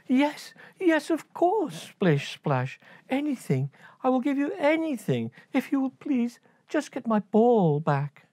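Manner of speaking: high, fast pleading; high girlish begging; desperate princess. Acted.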